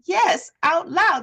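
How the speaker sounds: high, slow, emphatic insistence; emphatic yes. Retold or imitated. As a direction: stretch out loud to drive it home. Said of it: "I'm like".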